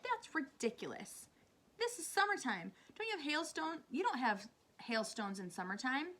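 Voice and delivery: high-pitched indignant protest; scornful disbelief. A narrator's reading. Centipede scoffs at James's news.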